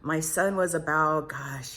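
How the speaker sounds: ordinary talk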